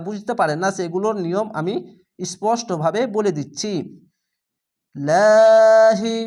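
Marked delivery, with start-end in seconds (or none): 4.9-6.2 লাহি chanted long and loud, thin la; drawn-out recited লাহি; Quranic recitation. Shown as a demonstration. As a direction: recite লাহি slowly with light lam. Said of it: "খাড়া জবর এক আলিফ টেনে পড়বেন".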